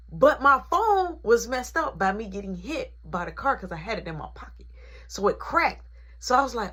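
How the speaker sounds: normal talking voice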